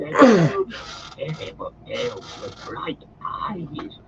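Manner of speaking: high, broken, sobbing Gollum; anguished, lost creature. Acted. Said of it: "his voice and language changed, and he sobbed".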